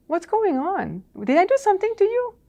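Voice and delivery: high, rising questions, acted out; puzzled questioning. Consultant asks client why he acts that way, puzzled. Retold confronting a disrespectful client.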